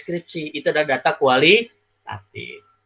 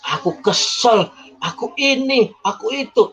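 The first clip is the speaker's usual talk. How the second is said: higher, slow whiny mock sob-talk; mock tearful complaint; 6 semitones higher than usual. Teacher imitating a girl crying over her ex, mock tearful. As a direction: act out a weepy heartbroken girl, lightly. Crying person explains why she cries.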